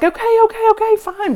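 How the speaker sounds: high, hurried giving in; mock scared driver. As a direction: squeak out a nervous surrender. Scared driver backs down quickly.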